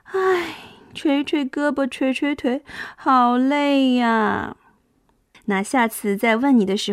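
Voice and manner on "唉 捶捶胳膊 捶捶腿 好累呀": play-acted tiredness. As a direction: act worn out for children. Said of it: "我们该是什么样的表情呢".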